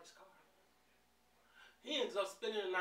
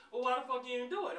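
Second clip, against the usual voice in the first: higher, fast, a bit louder indignant retort; indignant demand; 7 semitones higher, 4 dB louder, faster than usual. Retold or imitated. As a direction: Angela demanding why the narrator didn't act. Angela snapping at narrator.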